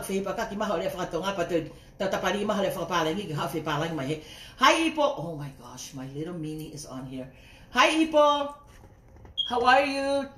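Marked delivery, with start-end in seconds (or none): 4.6-5.1 calling out hi. Real, not acted. Greeting a viewer joining live. 7.7-10.3 calling out hi. Real, not acted. Greeting a viewer joining live.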